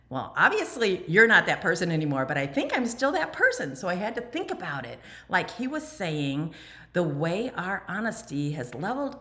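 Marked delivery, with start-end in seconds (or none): 0.1-3.8 louder, pointed thought that builds in pitch; wry inner retort. Retold or imitated. Recovering alcoholic silently answering her husband, pointed and wry. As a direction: answer him in your head, half teasing yourself. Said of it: "And I was thinking".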